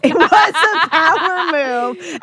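laughing through the words. Real, not acted. Echoing friend's joke, laughing.

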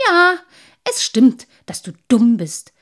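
loud, cutting comeback; high, loud start, emphatic jeer; scornful. A narrator's reading. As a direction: throw the words back as an insult. Man throws sprite's words back.

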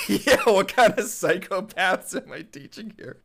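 high, loud, slowed disbelief; laughing, scandalised outburst; mock horrified teacher. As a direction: exclaim in mock horror through laughter.

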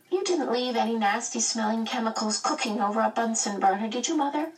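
high, lightly voiced child; small boy. A narrator's reading. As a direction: question mother in a little boy's high voice.

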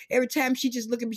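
ordinary talk, nothing marked

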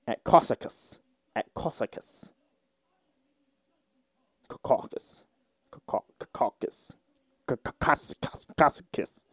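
quiet, halting attempts at a tricky word; under-the-breath practice. Real, not acted. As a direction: whisper it to yourself, stumbling and retrying. Stumbles over a word, practising it.

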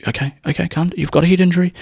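high-pitched, hurried backing off; quick, high appeasing voice; placating. Retold or imitated. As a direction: imitate nervously calming an angry person. Others placating him, retold.

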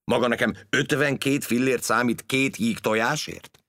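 put-on an old uncle fuming at the bill; outraged old uncle; indignant outburst. A narrator's reading. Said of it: "csattant fel Gyula bácsi".

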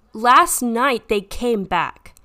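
loud, slow, frantic; panicked outburst. Acted. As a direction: blurt it out in panic. Caller breaks down to officer.

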